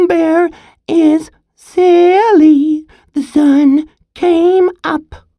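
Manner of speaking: high, slow, mocking sing-song taunt; teasing. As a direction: taunt the bear like a bratty kid. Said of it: "began to tease Bear".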